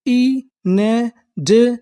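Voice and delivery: Inadequate drawn out slowly, rising at the end; slow syllable by syllable. Shown as a demonstration. Teacher sounding out the word for students, very slow. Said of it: "तो हमारी पूरी meaning क्या बनी?".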